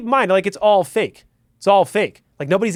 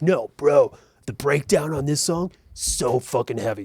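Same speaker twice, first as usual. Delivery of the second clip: mock metalhead bro; 6 semitones lower than usual. Mocking metalhead explaining breakdowns.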